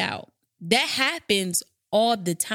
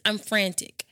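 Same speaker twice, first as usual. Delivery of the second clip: ordinary talk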